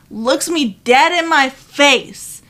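ordinary talk, nothing marked

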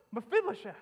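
loud, high cry of the name; cries out. Acted. King David crying out to the crippled grandson, loud high call. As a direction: call the name out with full feeling. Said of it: "he just cries out".

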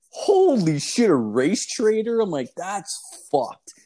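high, loud incredulous outburst; shocked disbelief. Retold or imitated. Shocked reply to racist remark.

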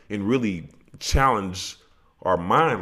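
ordinary talk, nothing marked

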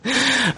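short high-pitched laugh; brief self-mocking giggle; laughing at oneself. Real, not acted. Host caught complaining during the review, laughing at himself. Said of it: "I'm laughing at myself".

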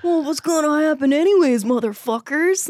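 high, loud mock challenge; brash acted-out comeback; defiant retort. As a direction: throw it out loud and high at the doubters. Acting out her defiant reply.

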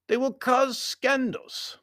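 very high, slow warning; foreign Baron. A narrator's reading. Baron warning of scandal, high formal voice. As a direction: warn him slowly, pitched well up.

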